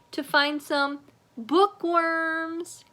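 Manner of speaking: high, loud, slow gleeful delivery; gleeful punchline. A narrator's reading. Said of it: "Kirk gleefully answered".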